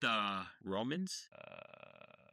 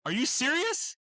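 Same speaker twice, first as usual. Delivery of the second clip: stunned disbelief; 5 dB louder than usual. Real, not acted. Shocked friend read the book.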